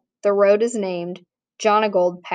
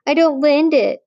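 high, louder nasal-less whine mocking "I don't lend it"; mocking whiny voice; 7 semitones higher, 5 dB louder than usual. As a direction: sneer it back in a bratty whine. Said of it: "He mocks Calvin's whiny voice".

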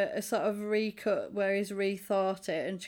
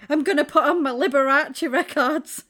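loud, higher mock excitement; giddy announcement; 5 semitones higher, 9 dB louder than usual. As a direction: announce it like a thrilled fan. Acting out the happy character.

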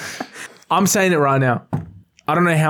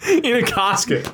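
higher, laughter in the voice; laughing; 8 semitones higher, slower than usual. Real, not acted. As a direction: say it while giggling. Cracking up at the joke.

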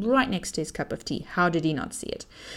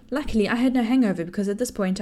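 usual speaking voice

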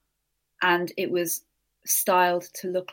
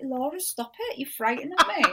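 mock frightened plea; 6 semitones higher than usual. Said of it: "I'm like".

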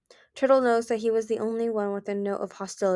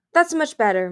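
higher, louder quick-to-object interjection; interjecting; 8 semitones higher, 9 dB louder than usual. A narrator's reading. Mind Reader defending their rainforest home, interjecting. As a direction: jump in brightly over the others. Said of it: "Mind Reader interjected".

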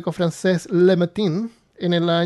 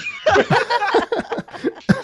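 high burst of giggling laughter; delighted laugh. Real, not acted. Host hears his friend's dad found out, laughing high. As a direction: crack up at the news. Father found out about tattoos.

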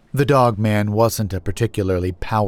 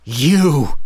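raised, forceful angry outburst; spat with rage; 6 semitones higher, 4 dB louder than usual. A narrator's reading. Narrator about to lunge at the brother, enraged. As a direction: spit the word out in fury. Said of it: "I spat, body vibrating with rage".

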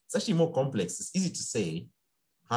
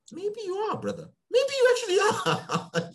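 much higher and laughing, teasing the drinker; mocking higher voice; 4 dB louder than usual. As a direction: pitch it way up and laugh through it.

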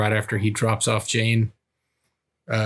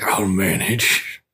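gruff tough guy; 3 semitones lower, much slower than usual. Retold or imitated. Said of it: "And he's like".

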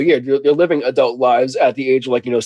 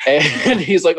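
normal talking voice

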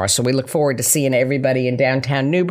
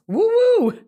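excited whoop. Real, not acted. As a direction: whoop it up like a cheer. Celebrating Las Vegas launch.

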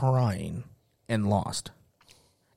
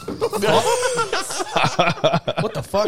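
shocked; 6 dB louder than usual. Retold or imitated. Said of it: "I'm like".

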